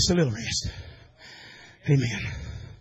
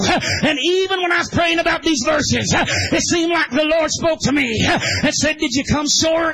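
chanted preacher's cadence, half shouted; whooping holiness sermon; preaching at full force; 7 semitones higher than usual. Real, not acted. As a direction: drive it home like a revival preacher.

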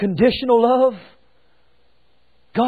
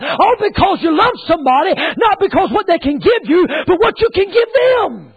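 rolling pulpit cadence building higher; forceful preaching; 4 semitones higher than usual. Acted. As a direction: drive each phrase to the climax.

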